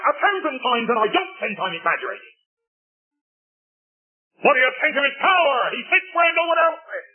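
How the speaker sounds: fervent preaching. Acted. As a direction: drive the praise home with growing fire. Preacher rising to full force.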